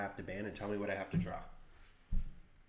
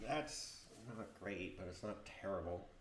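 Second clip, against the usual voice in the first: normal talking voice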